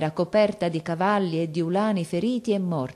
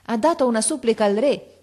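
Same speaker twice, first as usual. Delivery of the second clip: raised, higher voice; louder remark; 5 semitones higher, 5 dB louder than usual. A narrator's reading. Onlooker in a curious group, said louder. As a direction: speak up over the others. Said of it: "disse uno più forte".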